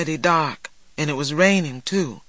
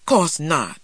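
ordinary delivery, nothing marked

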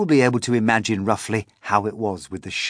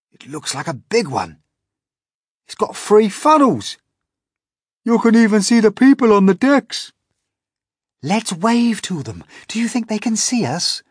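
high, loud and eager; thrilled children at the rail; excited child; 14 semitones higher, 11 dB louder than usual. A narrator's reading. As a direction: point it out to friends with delight. Children excited over the ship.

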